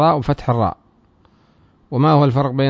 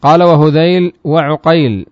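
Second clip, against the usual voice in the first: raised, louder recitation of the source text; reading the text aloud; 3 semitones higher, 7 dB louder, slower than usual. Shown as a demonstration. Teacher reciting the grammar text to students, raised voice. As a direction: recite the matn line for the class.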